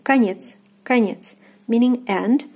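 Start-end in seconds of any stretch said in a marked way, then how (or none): 0.0-1.3 конец as "ka-NYETS", stress on е; careful falling model конец; unstressed O as A. Shown as a demonstration. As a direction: say конец with о turned to "a". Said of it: "is pronounced as A".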